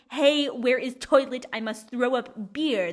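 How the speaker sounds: slow, loud, broken-language plea; clumsy foreign speaker. Acted. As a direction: blurt it word by word like a clumsy learner. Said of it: "And then in German, I'm like".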